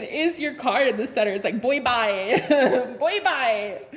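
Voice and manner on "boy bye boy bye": sassy kiss-off breaking into laughter; amused, punchy brush-off; laughing dismissal. Acted. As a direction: dismiss them, cracking yourself up. Acted dismissal, laughing at own line.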